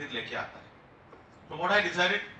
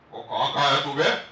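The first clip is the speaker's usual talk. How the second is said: rough, louder Jat-style challenge; Jat speech; 2 semitones lower, 6 dB louder than usual. Retold or imitated. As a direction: bark it like a Jat soldier. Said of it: "उनकी भाषा ही वैसे जाट हो गई". (जाट लहजे में कड़क सवाल)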